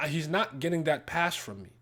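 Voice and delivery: ordinary talk, nothing marked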